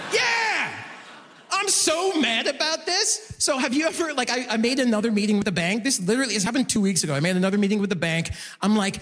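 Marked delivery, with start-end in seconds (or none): none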